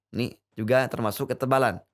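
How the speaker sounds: normal talking voice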